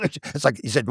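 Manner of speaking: usual speaking voice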